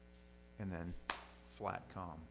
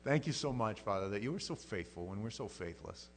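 soft earnest pastor's prayer; closing prayer; 3 semitones lower, 3 dB quieter than usual. Acted. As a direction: pray it gently over the congregation.

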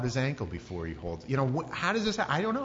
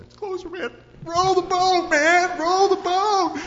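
high screamed panic, building louder; panicked yelling; 11 dB louder, slower than usual. Acted. Frightened rower to crewmate as the ghost nears, screaming. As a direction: yell at your crewmate to get away, drawing out the last words.

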